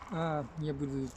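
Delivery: usual speaking voice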